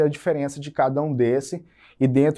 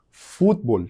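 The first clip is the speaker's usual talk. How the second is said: "futebol" as Spanish FUT-bol, two syllables; stress on first syllable. Shown as a demonstration. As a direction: hit FUT in "futebol", dropping the middle e. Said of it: "eu tenho fut lá em cima".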